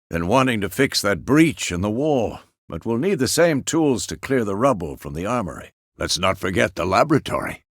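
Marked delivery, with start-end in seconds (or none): none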